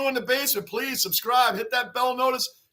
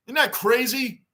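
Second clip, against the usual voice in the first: ordinary talk, nothing marked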